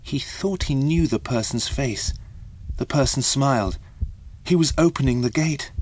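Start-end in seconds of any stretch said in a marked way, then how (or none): none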